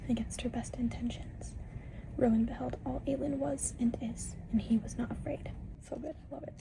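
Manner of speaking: hushed unvoiced whisper throughout; soft secret whisper reading a passage. Real, not acted. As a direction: whisper so nobody else wakes. Late-night whispered book reading.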